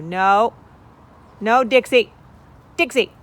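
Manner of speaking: loud high scold at a chicken; scolding shout. Real, not acted. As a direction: shoo the hen off, sharply.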